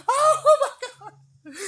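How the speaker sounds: squealed high, laughing "Oh, my God"; shocked crowd squeal. Retold or imitated. Onlookers on the bridge watching him get bitten, squealing high. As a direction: shriek it like a stunned onlooker, laughing.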